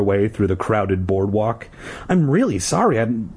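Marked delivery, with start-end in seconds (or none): 2.1-3.3 flustered apology. A narrator's reading. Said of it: "P D cried".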